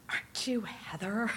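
whispered, almost no voice, as the woman leaning in; hushed whisper. Retold or imitated. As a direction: breathe it like a shy stranger asking. Retold stranger stopping her after Mass.

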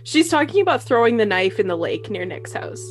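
usual speaking voice

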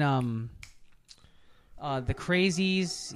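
normal talking voice

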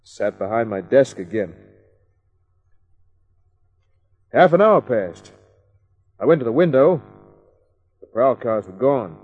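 hardboiled first-person voiceover; noir detective narration. A narrator's reading. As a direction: tell it like a world-weary private eye. Kent narrates after the visit.

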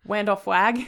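Wendell Flagg echoed with a W for the R; mocking W pronunciation. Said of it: "all those W's super, super bugged me".

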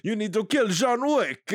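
high, exaggerated French accent; cartoon French villain accent; mock French accent. Shown as a demonstration. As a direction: deliver it as a hammy French-accented order. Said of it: "that's how they talk".

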